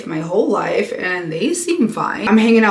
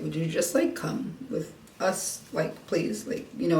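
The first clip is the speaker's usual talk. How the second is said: softer, hushed coaxing plea; hushed pleading; 2 semitones lower, 12 dB quieter than usual. Retold or imitated. John coaxing her to join the boat trip, hushed plea. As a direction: gently coax her to just come along.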